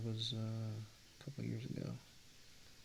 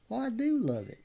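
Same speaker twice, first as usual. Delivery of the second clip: put-on Middle Texas accent; 13 semitones higher, 9 dB louder than usual. Imagined fan swears he loves the art, loud Texas accent. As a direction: protest loudly like a hammed-up Texan. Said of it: "he's, uh, he's really from Middle Texas".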